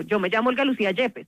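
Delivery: plain, settled self-introduction; firm tone. Shown as a demonstration. Answering someone who asks her name, firm and matter-of-fact. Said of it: "en un tono firme".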